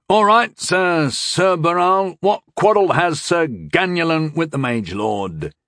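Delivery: loud formal hail; calling loudly. A narrator's reading. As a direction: call it across formally, drawing out the opening. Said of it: "he called loudly".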